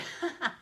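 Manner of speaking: short amused laugh. Real, not acted. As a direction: laugh lightly to yourself. Amused by viewers saying hi.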